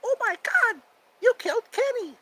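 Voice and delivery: very high cartoon kid outcry; South Park impression. South Park kid crying out over Kenny, very high-pitched. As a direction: squeal it like a cartoon child. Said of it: "That and South Park".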